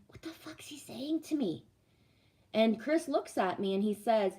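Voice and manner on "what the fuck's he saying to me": whispered aside to her ex; retold whisper. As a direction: hush it so the doctor can't hear. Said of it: "and I'm like".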